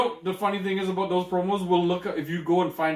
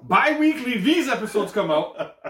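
high, loud, slowed declaration; loud, deliberate insistence; emphatic announcing; 6 semitones higher, 4 dB louder, slower than usual. Real, not acted. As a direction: force the point through laughter. Insists on finishing his sentence.

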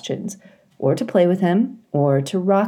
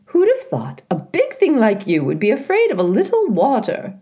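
higher, teasing goose voice; laughing old goose; 6 semitones higher than usual. A narrator's reading. Loudwing teasing Roz about water, amused goose voice. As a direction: tease the big robot, amused. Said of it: "Loudwing laughed".

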